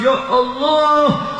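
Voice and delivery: high, long-drawn wail to God; retold weeping cry. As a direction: draw out each word high, as a retold lament. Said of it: "Sahabat Abu Bakar tambah nangis".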